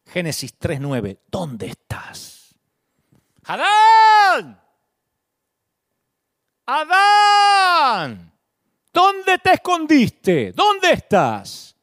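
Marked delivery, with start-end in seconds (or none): 1.3-2.5 slow, soft sorrowful question; sad voice of God. Acted. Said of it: "la voz sonó triste". 3.4-11.8 God calls out high and loud in sorrow; sorrowful calling. Acted. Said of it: "Pienso que la voz sonó triste".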